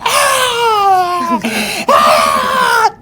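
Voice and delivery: high, loud pained scream; piercing mock wail of pain; re-enacted screaming. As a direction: scream as if a tooth is being pulled. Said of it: "I started screaming and screaming".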